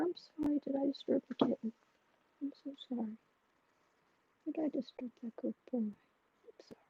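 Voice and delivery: hushed, soft apology to the pet; tender murmured sorry to a pet. Real, not acted. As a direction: coo a sorry to the animal you woke.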